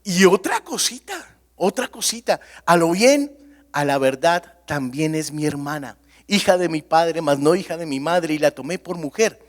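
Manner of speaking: mock-innocent patriarch making excuses; Abraham excusing himself. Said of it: "llega y dice".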